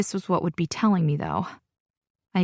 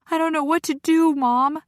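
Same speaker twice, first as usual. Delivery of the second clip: desperate; 9 semitones higher, 4 dB louder than usual. Acted. Panicked daughter pleading.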